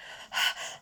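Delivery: a heavy, breathless pant; panting breath. Acted. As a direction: gasp for air after running. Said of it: "panted Barney".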